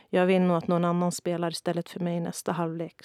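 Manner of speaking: ordinary delivery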